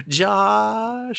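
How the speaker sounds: high, drawn-out name call; mock sing-out greeting; girl calling out. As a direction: imitate a girl greeting her date from afar. Girl calls out boy's name.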